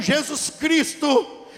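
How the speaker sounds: ordinary talk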